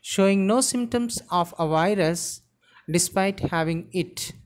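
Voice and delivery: ordinary delivery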